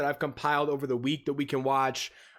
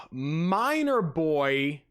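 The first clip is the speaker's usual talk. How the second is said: slow; much slower than usual. Real, not acted.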